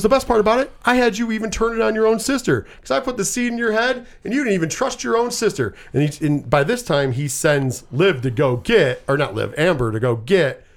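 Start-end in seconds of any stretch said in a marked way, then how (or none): 0.0-5.7 higher, mocking taunt; a hint of a smug villain, pitched higher; gloating killer boyfriend. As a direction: lift the voice high and sneer it out. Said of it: "And he goes, he goes".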